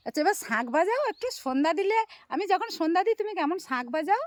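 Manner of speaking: high, loud coaxing; coaxing the bird. Real, not acted. Owner urges the bird to do its conch sound, high loud coaxing.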